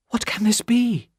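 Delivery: a wife startled by a knock, lightly frightened; trembling. A narrator's reading. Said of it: "said Lucy, trembling".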